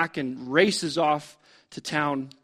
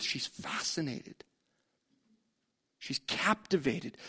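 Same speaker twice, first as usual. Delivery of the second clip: breathless whisper of wonder; awed whisper; 5 semitones lower, 13 dB quieter than usual. Acted. Preacher describing the captivated woman, in an awed whisper. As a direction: whisper it as if spellbound.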